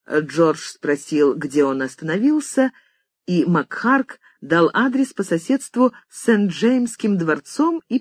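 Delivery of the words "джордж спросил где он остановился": ordinary delivery, nothing marked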